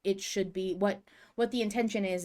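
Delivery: usual speaking voice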